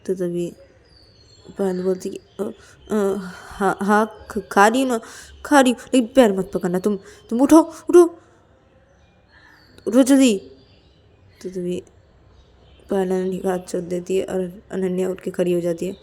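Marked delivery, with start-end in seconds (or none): 2.4-10.4 hesitant start, then loud urging; flustered Payal. Acted. Payal gives in and tells Ananya get up, flustered. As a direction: stammer agreement, then order her up higher and louder.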